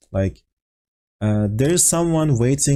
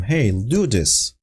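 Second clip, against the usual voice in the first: frustrated order; slower than usual. Shown as a demonstration. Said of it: "it is obvious that you are frustrated".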